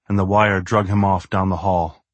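usual speaking voice

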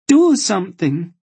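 high, loud demand. A narrator's reading. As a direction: insist desperately, pushing the voice up. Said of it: "she demanded".

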